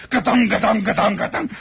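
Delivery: bumping noise. Retold or imitated. Car bouncing along railroad ties, rhythmic thumping noise. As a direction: chant the bouncing car like a drum.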